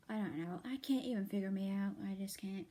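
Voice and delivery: ordinary delivery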